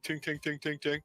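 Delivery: ting out each tap like a typewriter key; mock typewriter ting. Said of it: "miming the exaggerated taps of a typewriter".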